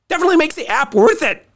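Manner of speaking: high, fast burst of enthusiasm; excited. Acted. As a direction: gush about it, pitch way up. Gushing in sponsor ad.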